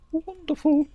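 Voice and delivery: squeaky delighted wonderful; high wonderful. Acted. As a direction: chirp it out high. High far exclamation.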